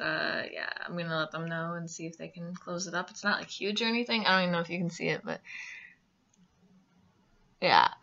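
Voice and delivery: lips stretched by fingers; talking with finger on lip; fingers pulling lips apart. Real, not acted. Seen 1: fingers pull lips apart, then lower lip down, showing braces.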